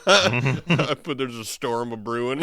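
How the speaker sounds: slow, loud, laughing ominous tease; mock ominous. Joking about the storm, slow and hammed up with a laugh. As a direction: intone it like a spooky trailer. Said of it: "ominous joke".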